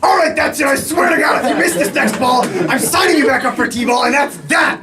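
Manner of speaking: high, exasperated shouting; fed-up mother. Acted. Mother warning her son before the last pitch, high fed-up shouting.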